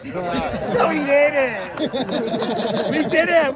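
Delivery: laughing. Real, not acted.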